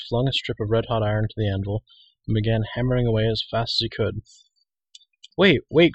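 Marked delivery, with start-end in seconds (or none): 5.4-5.9 much higher and louder alarmed call; urgent cry. A narrator's reading. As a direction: stop him before he ruins the iron. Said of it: "cried Cole".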